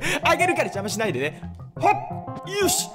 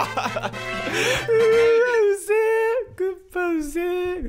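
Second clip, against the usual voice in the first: laughing whine; 8 semitones higher, much slower than usual. Real, not acted. Annoyed at item, laughing.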